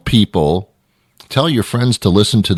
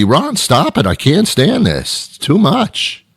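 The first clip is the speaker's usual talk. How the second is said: higher, whiny bladder complaining; voiced bladder; 7 semitones higher, slower than usual. Bladder complaining to Ron about water, higher and whiny. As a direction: play the fed-up bladder pleading. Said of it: "my bladder is yelling at me".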